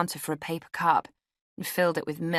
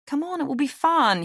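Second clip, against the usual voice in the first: higher-pitched wheedling man; coaxing; 11 semitones higher, 4 dB louder than usual. A narrator's reading. Man pressing a reluctant woman in bed, coaxing. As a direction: coax with a high, cajoling lilt.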